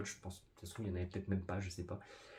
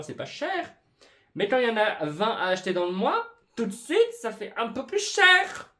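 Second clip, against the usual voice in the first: sarcastic; 11 semitones higher, 10 dB louder than usual. Pretend. Ironic rant about costs.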